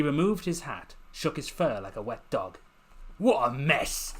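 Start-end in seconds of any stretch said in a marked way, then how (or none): 3.2-4.1 gruff grumble. Acted. Werewolf grumbling, shaking fur.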